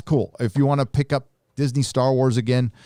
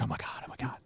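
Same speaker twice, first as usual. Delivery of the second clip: whisper; 16 dB quieter, faster than usual. Retold or imitated. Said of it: "She's like".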